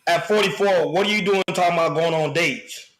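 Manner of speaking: ordinary delivery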